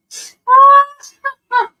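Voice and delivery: loud high burst of laughter; squealing laugh; high shrieking laugh. Real, not acted. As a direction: cackle helplessly. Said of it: "now I'm snorting".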